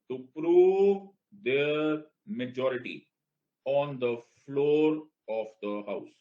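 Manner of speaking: "to prove their majority" very slow, "prove" stressed; slow dictation. Shown as a demonstration. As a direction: dictate each word slowly for notes. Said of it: "language पकड़ो बेटा". (धीरे धीरे लिखवाते हुए, "prove" पर ज़ोर)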